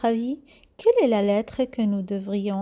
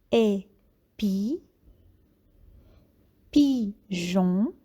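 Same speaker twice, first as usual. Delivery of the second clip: ordinary talk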